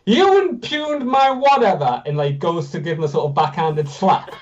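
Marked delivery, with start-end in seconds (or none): 0.1-2.0 put-on high, slow, huffy young voice; indignant kid. A narrator's reading. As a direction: play a pompous skinny kid. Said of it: "this stupid sort of rail-thin kid goes".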